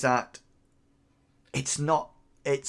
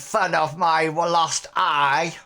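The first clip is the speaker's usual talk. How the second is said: higher crackling crone voice; indignant hag, pitched up; offended old hag; 4 semitones higher, 4 dB louder than usual. Acted. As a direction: accuse them as a wounded old crone. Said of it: "this toothless hag of a woman".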